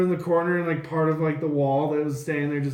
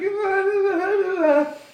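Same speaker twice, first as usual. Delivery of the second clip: high mock instrument noise; frantic high "na-na" playing; frantic musician imitation; 4 dB louder than usual. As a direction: babble a frantic tune in panic. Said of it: "the one playing right now is just like".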